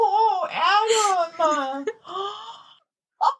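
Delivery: high, drawn-out laughing wail; laughing to the point of tears; helpless laughter. Real, not acted. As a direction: wail it through a real laughing fit. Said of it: "can't stop laughing".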